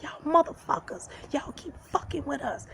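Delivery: high-pitched mock yell at the guards; retold yelling. Cuffed woman yelling at police beating her boyfriend, high mock yell. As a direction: shriek it at the cops hitting him. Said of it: "I'm just yelling and screaming".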